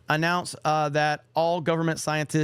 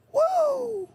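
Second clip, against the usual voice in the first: strained, much higher hoarse Ric Flair woo. Retold or imitated. Streamer attempts a Ric Flair woo, hoarse and cracking. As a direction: squeeze out a cracking wrestler's woo. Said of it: "I'm gonna try to do a real Ric Flair".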